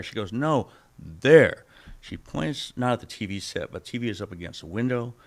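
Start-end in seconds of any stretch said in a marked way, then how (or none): 0.3-1.6 insistent. Retold or imitated. Wife pointing insistently.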